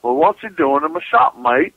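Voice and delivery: imitating a British shopkeeper, loud and high; British accent. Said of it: "in his best, uh, British accent and goes".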